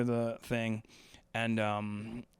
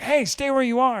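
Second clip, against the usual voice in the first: higher and louder police order; cop command; 10 semitones higher, 6 dB louder than usual. Retold or imitated. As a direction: bark it like a cop on the scene. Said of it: "they're like".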